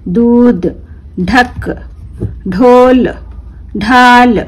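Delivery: each word drawn out slowly, clearly; careful drawn-out classroom reading; slow word-list reading. Shown as a demonstration. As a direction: read it slowly for children to repeat. Teacher reads word list aloud.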